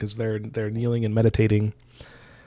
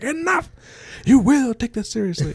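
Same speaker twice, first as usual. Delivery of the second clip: mock outburst; 13 semitones higher, 6 dB louder than usual. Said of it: "And she's like".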